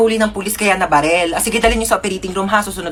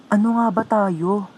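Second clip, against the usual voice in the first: soft, slow question; 3 semitones lower, 6 dB quieter than usual. Acted. Romantic line, then repeated back.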